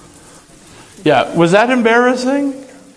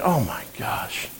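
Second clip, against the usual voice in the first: mortified mumble; 6 semitones lower, 13 dB quieter than usual. Retold or imitated. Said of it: "I'd be like".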